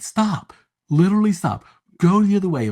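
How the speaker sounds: husband urging his wife away from the lion, high urgent plea; mock urgent warning. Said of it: "Val Kilmer's like".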